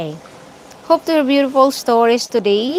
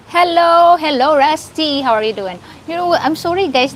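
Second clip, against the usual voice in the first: ordinary talk, nothing marked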